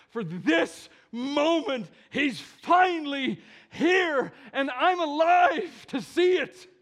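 slow, building to high, loud exultation; elated shouting. Acted. Preacher as an onlooker seeing the long-awaited king, shouting with joy. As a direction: start measured, swell into an overjoyed shout.

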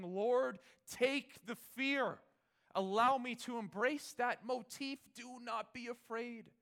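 loud, high pleading prayer; preacher voicing a desperate prayer to God; fervent prayer. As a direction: cry out to the Lord. Voiced prayer inside sermon.

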